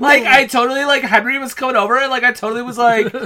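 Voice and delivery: loud, bubbly mock valley girl; valley girl Catherine. Podcast host mocks the princess as a Valley Girl, loud and bubbly. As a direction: ham up a ditzy teen gushing about Henry.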